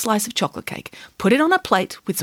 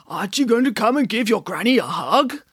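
wolf as granny. Acted. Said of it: "cried the wolf".

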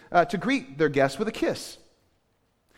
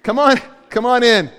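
loud, raised-pitch holler; yelled invitation; 5 semitones higher, 10 dB louder than usual. Acted. Host hollering a guest in from inside, yelled. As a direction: call it out loudly to someone at the door. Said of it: "I just hear you yell".

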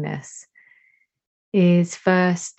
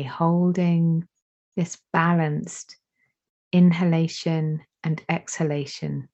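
slow, calm meditation guide voice; guided meditation. Acted. Teacher guiding attention to breath and heart, slow meditation voice. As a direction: let each phrase settle, unhurried.

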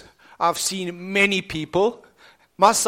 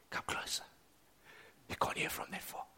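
unvoiced hush; soft whispered invitation; gentle whisper; 21 dB quieter than usual. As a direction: whisper it like God close to the ear. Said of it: "gentle whisper".

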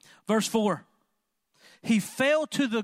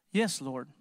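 low, soft, calm yes; humble answer; 7 semitones lower, 3 dB quieter than usual. Acted. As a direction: answer like a willing servant. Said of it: "he responded this way".

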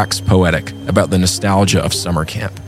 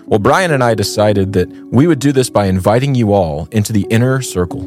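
usual speaking voice

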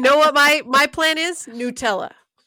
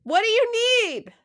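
from another room; 11 semitones higher than usual. Real, not acted. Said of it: "my wife yelling from the other room".

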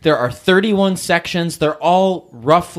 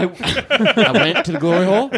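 Vermont twang, laughing; 5 semitones higher than usual. Retold or imitated. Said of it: "that beautiful twang".

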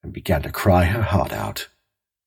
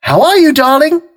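high, loud sing-song call; 7 dB louder than usual. A narrator's reading. Wife calling her husband from the back door, high and loud. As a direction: call him cheerily from the kitchen.

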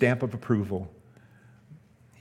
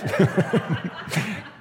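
high, amused laugh; 8 semitones higher than usual. Real, not acted. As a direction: laugh at your own startled moment. Laughs at his own fright story.

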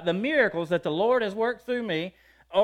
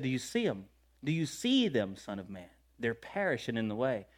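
low, hushed voice of God speaking; a softer, lower voice of the Lord calling his servant; 7 semitones lower, 8 dB quieter than usual. Retold or imitated. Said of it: "He said".

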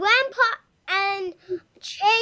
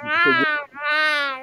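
dinosaur roar; 5 dB louder, slower than usual. Pretend. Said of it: "The dinosaur's back".

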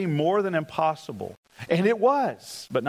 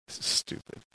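muttered aside; 7 dB quieter than usual. Retold or imitated. Retold inner thought, mumbled aside.

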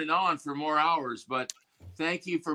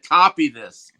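loud quote; 10 dB louder than usual. Retold or imitated. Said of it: "and said".

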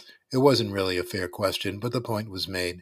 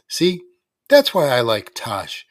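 taunting; 3 semitones higher, 8 dB louder than usual. A narrator's reading. Danny taunting with a bow.

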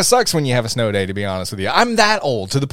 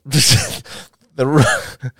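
normal talking voice

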